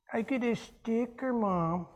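put-on slow, soft little-kid voice; little child. Five-year-old telling Mom about a sticker, child voice. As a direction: play a small child showing Mom a prize.

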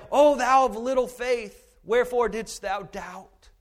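solemn scripture reading. Acted. Reading Jesus' words.

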